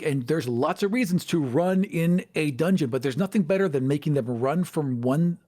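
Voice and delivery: normal talking voice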